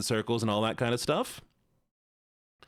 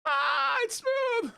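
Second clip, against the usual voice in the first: excited shout; much slower than usual. Real, not acted. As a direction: yell it out with glee. Car running smooth, excited shout.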